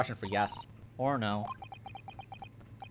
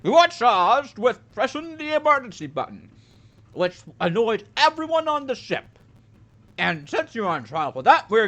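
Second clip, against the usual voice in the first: judge voice; 8 semitones higher, 7 dB louder than usual. Acted. Voicing the judge's mock verdict.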